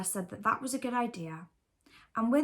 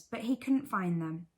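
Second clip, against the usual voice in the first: ordinary delivery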